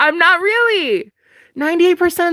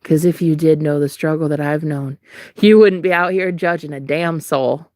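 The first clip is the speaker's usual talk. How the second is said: mock solemn scolding; 11 semitones lower than usual. Dramatic speech to judging viewers.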